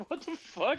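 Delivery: high squeaky disbelief, imitating the kicked-out guy; mock confused guy. Friend mimics the stranger Connor kicked out, high and baffled.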